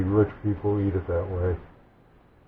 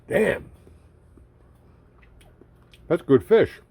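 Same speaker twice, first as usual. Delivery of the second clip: delighted; 9 semitones higher, 5 dB louder, slower than usual. Real, not acted. Tasting fish, delighted.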